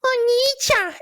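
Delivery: put-on very high girly squeal; high anime girl voice. Shown as a demonstration. Voice actor demoing female anime voice. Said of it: "I was the female voice".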